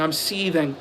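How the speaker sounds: "seething" dropped soft and flat. Pretend. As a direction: murmur "seething" with deadpan sarcasm.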